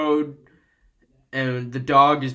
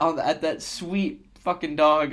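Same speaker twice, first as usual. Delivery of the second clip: normal talking voice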